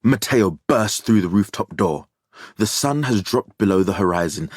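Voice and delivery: plain reading voice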